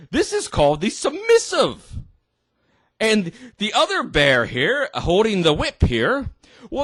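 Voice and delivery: high, singsong presenter talking to a teddy; mock children's show host. Host explaining to Teddy, mock-cheery children's presenter.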